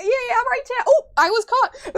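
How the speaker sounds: high, loud imitation of yourself flailing; excitable self-mocking squeal; mock flustered. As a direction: play up getting caught mid-boast. Mocks herself flailing on stream.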